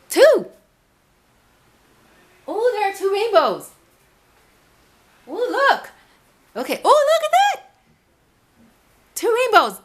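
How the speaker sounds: very high, loud cries of wonder; amazed delight. Real, not acted. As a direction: gasp at the double rainbow. Amazed at two rainbows.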